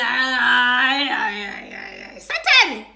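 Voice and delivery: scandalized cry; shocked disgust. Retold or imitated. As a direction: recoil in horror. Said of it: "I'm like".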